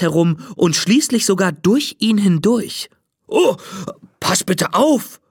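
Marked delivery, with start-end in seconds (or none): 3.3-5.2 a touch of alarm, slow; mild startled call. A narrator's reading. Ghost startled as mermaid swims through him, mild call. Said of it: "ruft der Geist".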